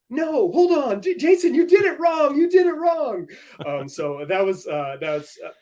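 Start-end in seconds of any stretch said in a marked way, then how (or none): 0.1-3.3 higher, louder correcting a friend; mock protest. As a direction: scold him playfully up high. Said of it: "I'm like".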